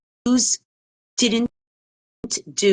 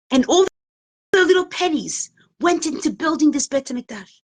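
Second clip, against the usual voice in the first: acted outrage, building from measured to high and loud; indignant reproach; 4 semitones higher, 4 dB louder than usual. Mother confronting the rabbi over the burnt model, mounting indignant reproach. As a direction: accuse him, hammering each phrase between pauses.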